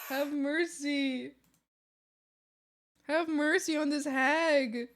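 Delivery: slow, whiny begging; hammed-up pleading hag. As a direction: beg for mercy like a pitiful hag.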